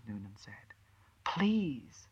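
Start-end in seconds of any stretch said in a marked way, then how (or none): 1.2-2.1 whispered, pleading please; soft whispered begging. Acted. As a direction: breathe the word as a plea. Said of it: "she begged".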